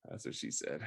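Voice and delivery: quiet unvoiced whisper; whispered joke. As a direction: whisper the punchline.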